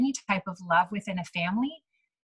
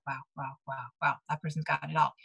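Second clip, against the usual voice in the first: softer, lower private awe; inner voice; 6 semitones lower, 6 dB quieter than usual. Retold or imitated. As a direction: think it to yourself in quiet wonder. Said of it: "in my brain".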